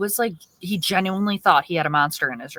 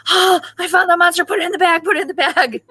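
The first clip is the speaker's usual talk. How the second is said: high, urgent monster-catcher cry; mock panic; 11 semitones higher, 4 dB louder, faster than usual. Papa catching the monster for the boy, mock panic. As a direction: imitate a frantic monster hunt, pitched way up.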